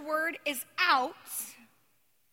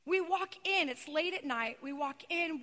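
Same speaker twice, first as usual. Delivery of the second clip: normal talking voice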